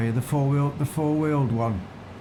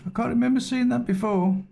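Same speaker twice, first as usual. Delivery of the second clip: much higher, amazed; surprised; 11 semitones higher than usual. Real, not acted. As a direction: pitch jumps up in surprise. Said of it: "Oh, it is, yeah".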